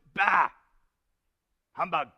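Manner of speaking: loud, abrupt dismissal; Scrooge. Acted. Uncle rebuffs his nephew's Christmas greeting, dismissive outburst. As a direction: throw it out as a grumpy outburst. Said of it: "said Scrooge".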